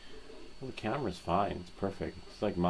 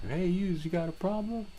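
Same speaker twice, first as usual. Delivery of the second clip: very high, louder cartoon New Yorker; New York accent; 13 semitones higher, 4 dB louder than usual. Showing chat a typical New Yorker, big mock New York accent. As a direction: push the Brooklyn tough guy over the top. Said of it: "a typical New Yorker".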